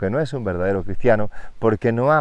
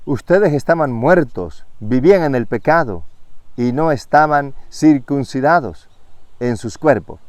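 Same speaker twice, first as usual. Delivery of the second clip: louder, proclaiming the verse to the congregation; forceful scripture reading; 3 semitones higher, 3 dB louder than usual. Acted. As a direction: read it like a call from the pulpit. Reads Colossians with force.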